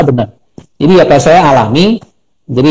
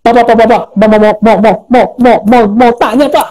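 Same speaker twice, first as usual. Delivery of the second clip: rapid-fire repeated syllables, pitched up; mocking stutter; 4 semitones higher than usual. Friend on the phone teasing a stutterer, mock stammering. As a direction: imitate someone teasing with an exaggerated stutter. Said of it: "dia mau ngeledek saya sebenarnya".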